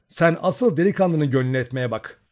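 shouted tease. A narrator's reading. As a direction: tease her loudly. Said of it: "bağırdı". (genç anne yüksek sesle takılıyor)

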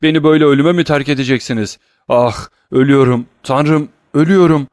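loud, high desperate plea of a dying man; crying out. A narrator's reading. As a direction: cry out in terror of death. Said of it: "diye bağırıyordu Barois".

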